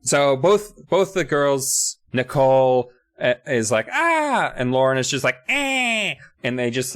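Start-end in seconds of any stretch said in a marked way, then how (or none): 3.9-4.5 high-pitched "ah" scream; mock freak-out scream. Girl freaks out at the open trunk, high mock scream. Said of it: "both freak out". 5.5-6.1 high nasal "eh" squeal; mock freak-out shriek. Nasal friend freaks out at the trunk, high mock squeal. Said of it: "both freak out".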